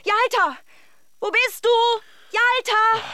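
high, loud frantic call across the street; calling a lost dog, searching desperately. Acted. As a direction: shout the dog's name in panic.

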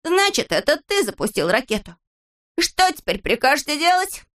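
high, agitated scolding; exasperated high-voiced blame; indignant accusation. Acted quarrel, blaming his friend.

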